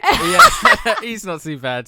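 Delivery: laughing through the words. Real, not acted. Guest laughs while agreeing that [name] is fine, laughing. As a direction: chuckle it out, amused.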